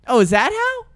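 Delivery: high, doubting "is that how" voice; mock clueless lady. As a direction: play a baffled woman who won't trust the answer. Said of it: "Now what?".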